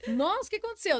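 imitating shocked strangers. Strangers staring at her pale arm, mock surprise. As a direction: play the gawking stranger at the gas station. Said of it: "o pessoal olhava assim pro meu braço".

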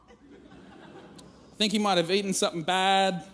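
put-on slow, giggling child quote; barely holding back laughter; laughing through the quote. Dad retelling his daughter's worry, laughing.

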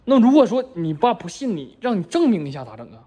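ordinary talk, nothing marked

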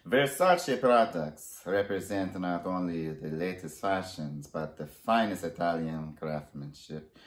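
amateur put-on Italian accent; attempted Italian accent; Donatello, Italian accent. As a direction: read it like an Italian designer, accent imperfect. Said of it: "I don't even know if this is an Italian accent".

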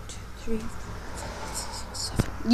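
counting under the breath; whispered counting. Real, not acted. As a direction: whisper the numbers. Counting secretly.

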